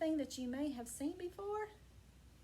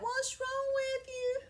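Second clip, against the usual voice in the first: higher and slow, gently asking; concerned donkey; 8 semitones higher than usual. Acted. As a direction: lean in and ask the sad cat. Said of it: "And the donkey asked the cat".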